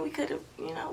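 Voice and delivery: a quick unvoiced whisper; whispered aside. To her husband, teasing whisper. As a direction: hush it like a private jab.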